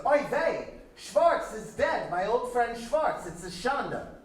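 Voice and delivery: put-on high, slow Yiddish-accented lament. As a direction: wail it like an old Borscht Belt joke, Yiddish accent. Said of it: "forgive my terrible Yiddish accent".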